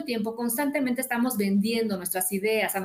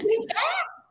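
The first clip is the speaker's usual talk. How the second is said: a high, real burst of laughter; slower than usual. Real, not acted. Retelling a friend's dismissive remark, she bursts out laughing.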